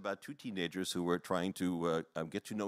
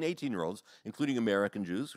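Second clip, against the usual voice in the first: ordinary talk, nothing marked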